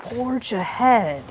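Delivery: slow, grand "forge ahead"; dramatic slow line. Acted. Voicing the hero's grand motto, very slowly. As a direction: stretch it out like an epic slogan.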